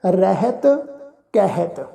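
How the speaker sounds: slow, drawn-out sacred recitation; measured Gurbani reading, sustained line endings; scripture recitation. Shown as a demonstration. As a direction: chant each phrase slowly with long held vowels.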